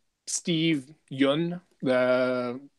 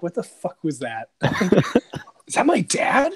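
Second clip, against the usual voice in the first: 1 laughing shock; 5 semitones higher than usual. Retold or imitated. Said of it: "Jon's like".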